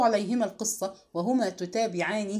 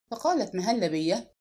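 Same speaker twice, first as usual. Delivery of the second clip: plain reading voice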